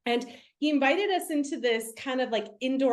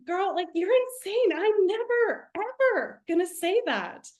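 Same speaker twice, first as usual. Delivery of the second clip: incredulous retort; 7 semitones higher than usual. Retold or imitated. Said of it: "And I was like".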